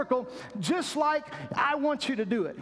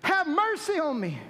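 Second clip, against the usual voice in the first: crying out; 8 semitones higher than usual. Retold or imitated. Bartimaeus crying out despite the crowd, pleading. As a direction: call out over the crowd. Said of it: "he began to cry out".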